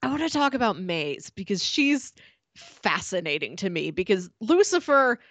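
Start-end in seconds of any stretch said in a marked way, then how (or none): none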